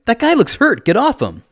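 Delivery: high, loud urgent shout. A narrator's reading. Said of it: "I shout".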